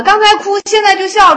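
high-pitched matter-of-course answer; breezy retort. Retold or imitated. Disciple answers puzzled colleague about crying then laughing, breezy high retort.

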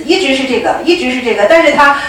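ordinary delivery

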